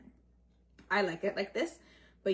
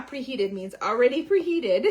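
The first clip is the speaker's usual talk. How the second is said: laughing; 4 semitones higher, 9 dB louder than usual. Real, not acted. Laughing at Spanglish brain.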